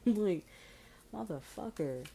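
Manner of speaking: a loud, high burst on like; half-laughing mock exasperation; exasperated outburst. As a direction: blurt it out fed up.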